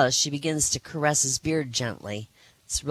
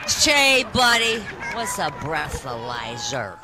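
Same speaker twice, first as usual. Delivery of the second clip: put-on slurred, loud, slow drunk voice; hammed-up drunk at the bar; 4 dB louder, slower than usual. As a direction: ask it like a tipsy barfly. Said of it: "one drunk asks his friend".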